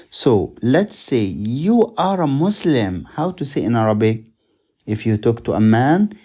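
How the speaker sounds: normal talking voice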